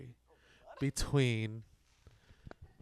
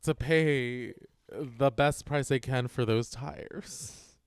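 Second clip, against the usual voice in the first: usual speaking voice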